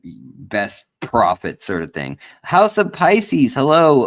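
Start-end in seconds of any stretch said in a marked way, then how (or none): 2.4-4.0 loud, bright hello to the viewers; loud greeting. Real, not acted. Host greeting his stream audience, loud and upbeat. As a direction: call out the channel greeting.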